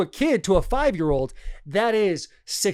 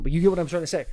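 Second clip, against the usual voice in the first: ordinary talk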